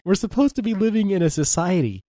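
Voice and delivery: high-pitched mock outrage; playfully appalled at bad manners; mock indignation. Mock outburst about conversation manners.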